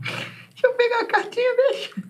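very high, thin creature voice; high creepy hand voice. Acted. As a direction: squeak it like a headless hand. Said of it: "Tá um pouco diferente".

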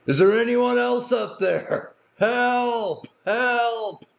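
high, pleading yells for anyone else; hanging man calls out high and desperate. Retold or imitated. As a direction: beg anyone else up there to answer. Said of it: "and says".

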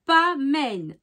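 slow, halting word-by-word reading; slow and deliberate, feeling out each syllable; careful foreign-phrase reading. Real, not acted. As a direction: sound out an unfamiliar regional phrase. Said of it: "avec mon accent, c'est, c'est pas".

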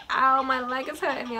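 ordinary delivery

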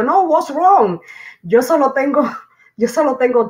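high, startled denial breaking into a laugh; surprised protest. Retold or imitated. Telling the officer she has only three kids, startled and laughing. Shocked at being told nine children.

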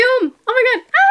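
high, giddy squeal; real excitement. Real, not acted. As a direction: burst out high, overjoyed at the perfume. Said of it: "I'm so excited".

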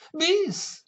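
high, drawn-out cry of "bis"; encore cry. A narrator's reading. Old lady at the concert demanding an encore, high cry.

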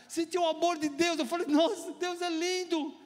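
high, loud wonder; elated testimony. Real, not acted. Preacher telling how he felt God's love, elated testimony.

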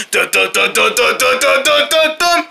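rapid dun-dun climbing in pitch; running sound. Acted. Showing off super-fast running to friends, rising dun-dun noise. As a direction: chant quick duns, rising steadily.

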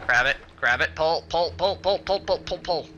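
rising high, loud chant of pull; frantic urging. Real, not acted. Gamer mashing buttons, urging pull in rising panic. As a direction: urge it on in a panic.

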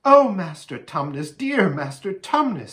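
high, effusive thanks; delighted, grateful exclamation; overjoyed gratitude. A narrator's reading. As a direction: gush with relief and affection. Grateful queen dances with Tumnus.

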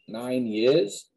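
ordinary talk

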